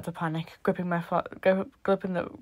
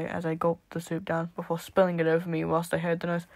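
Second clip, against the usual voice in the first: ordinary delivery, nothing marked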